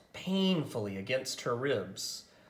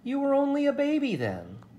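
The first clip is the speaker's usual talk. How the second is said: put-on much higher, louder child's disbelief; a high, loud exclaiming friend; exclaiming girl; 14 semitones higher, 6 dB louder, faster than usual. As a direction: throw it out high in amazement. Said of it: "Chizuko exclaimed".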